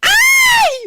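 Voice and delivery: thrilled high shriek; surprised shriek. Acted. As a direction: squeal with delight at the news. Hears the mayor is coming.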